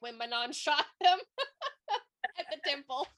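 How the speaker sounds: breaking into high laughter mid-line; laughing through it. Real, not acted. Friend recounting a shocking book twist, laughing through the words.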